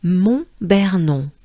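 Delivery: Mont-Bernon with nasal on, slowly; slow French pronunciation. Shown as a demonstration. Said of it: "they will arrive in".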